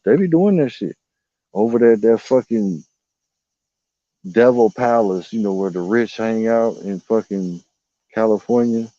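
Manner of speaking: normal talking voice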